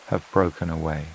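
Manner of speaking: ordinary delivery, nothing marked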